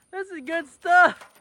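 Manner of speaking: ordinary talk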